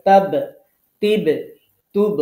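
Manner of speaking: তাব, তিব, তুব with the final ব bounced out hard; তাব, তিব, তুব — শেষের ব শক্ত করে ধাক্কা দিয়ে; firm qalqala. Shown as a demonstration. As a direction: make the ব at the end of তাব, তিব, তুব rebound firmly. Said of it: "আরো একটু, আহ, শক্ত করতে হবে".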